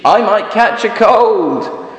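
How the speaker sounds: high, slow, loud worried sheep; fussy sheep. Acted. Sheep fussing about catching a cold, high and slow. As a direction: fret about the cold slowly. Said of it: "says the sheep".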